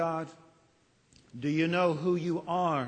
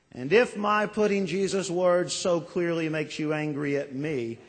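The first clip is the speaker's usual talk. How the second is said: ordinary talk, nothing marked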